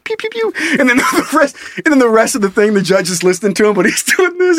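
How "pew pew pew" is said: mock gun noises. Finger gun sounds.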